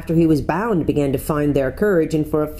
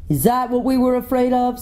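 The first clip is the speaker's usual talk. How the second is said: higher, sneering voice of another enemy; scornful creature; 8 semitones higher than usual. A narrator's reading. Enemy creature scoffing at Aslan, sneering higher voice. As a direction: scoff as if the threat was nothing. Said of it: "said another".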